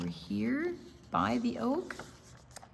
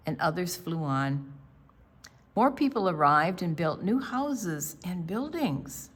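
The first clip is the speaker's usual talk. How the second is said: clear, steady storybook reading, a bit louder; story narration; 2 semitones lower, 6 dB louder than usual. A narrator's reading. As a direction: read the picture book to the class. Read-aloud story narration.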